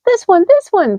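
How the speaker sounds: put-on high squeaky baby mice; tiny excited mouse voices; high little mouse squeak. A narrator's reading. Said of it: "squeaked the baby mice".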